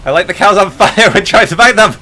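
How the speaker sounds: loud, high frantic yelling; panicked shout. Real, not acted. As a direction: yell in a panic at the burning cows. Panicked game moment.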